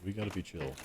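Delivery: soft mock chill. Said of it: "you have to start doing A S M R".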